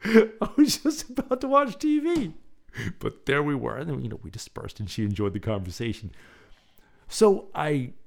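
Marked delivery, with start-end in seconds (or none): 0.0-2.3 high, mock-annoyed voice with a laugh; laughing mock protest, pitched way up; exasperated viewer. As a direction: play the irritated viewer interrupted before TV. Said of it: "You're probably thinking, like".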